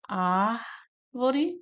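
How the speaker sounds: "árvore" very slow, first syllable held, then a pause. Shown as a demonstration. Teacher marking the syllables for students, "árvore" slowly divided. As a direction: stretch and separate the syllables of "árvore". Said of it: "Vamos separar aqui".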